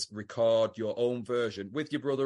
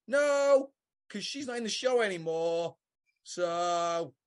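higher, put-on voice for the dad; a hint of a breezy father; mock dad voice; 7 semitones higher, slower than usual. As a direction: break the news in an odd put-on voice. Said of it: "And he goes".

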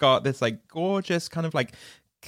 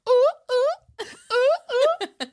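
very high, loud "ooh ooh"; silly high-pitched rhythmic oohs; imitated dance whoops; 4 dB louder, much slower than usual. Imitates dancer shaking his bum.

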